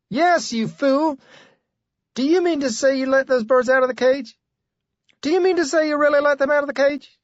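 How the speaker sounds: high, angry scolding woman; wife's voice. Acted. Wife scolding her husband over the freed birds, high and angry.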